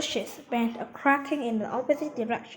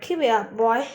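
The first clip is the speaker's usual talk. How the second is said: loud shout of encouragement; raised-voice urging; 8 dB louder than usual. A narrator's reading. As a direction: cheer him on loudly. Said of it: "shouted the professor".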